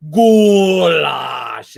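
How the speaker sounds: GOULASH hollered slow and loud; drawn-out goulash shout. Retold or imitated. Asked to say it again, repeats the long loud goulash shout. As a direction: stretch the word into a big shout. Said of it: "say that again".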